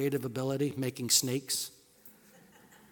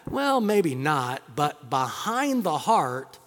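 ordinary delivery

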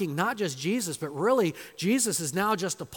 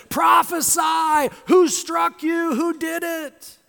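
high, drawn-out jeering demand; loud goading cry; taunting shout; 10 semitones higher, 8 dB louder, slower than usual. Acted. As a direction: shout it slowly, taunting a captive. Soldiers taunting blindfolded Jesus.

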